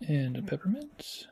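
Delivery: soft unvoiced; whispered concentration. Real, not acted. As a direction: hush it while placing. Whispered while placing.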